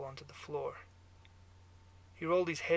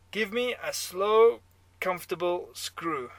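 put-on loud, high, slow German-accented drawl; brash barfly with a German accent; 5 semitones higher, 11 dB louder than usual. A narrator's reading. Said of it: "your German accent".

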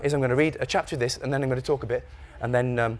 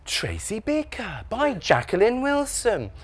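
very slow, high, softened story-time voice; teacher reading a title; 8 semitones higher, 5 dB quieter, much slower than usual. Acted. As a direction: stretch out the title like a doting teacher. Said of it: "Or they'll go".

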